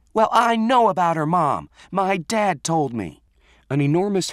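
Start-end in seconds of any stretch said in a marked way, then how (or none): 0.1-3.2 high, loud, angry outburst; spat out. A narrator's reading. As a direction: spit the words out in a raised, furious voice. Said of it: "Renee spat out".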